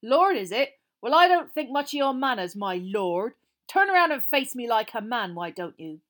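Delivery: loud, scornful challenge; rough. A narrator's reading. Frank daring the lord to face him, rough loud defiance. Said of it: "said Frank roughly".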